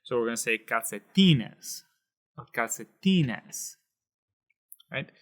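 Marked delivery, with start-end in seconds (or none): 0.6-1.8 calcetines with a strong punch on TI; stress on second-to-last syllable. Shown as a demonstration. Teacher showing where stress falls, calcetines punched on TI. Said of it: "second to the last is right here". 2.5-3.8 calcetines, drawn out slowly with TI punched; slow it down and hit TI in calcetines; stress on second-to-last syllable. Shown as a demonstration. Teacher repeating the example word, slow calcetines stressed on TI. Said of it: "that punch right there".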